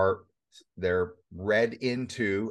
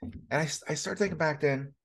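ordinary talk, nothing marked

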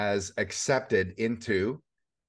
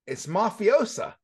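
indignant; 12 semitones higher, 4 dB louder than usual. Real, not acted. Outraged reveal.

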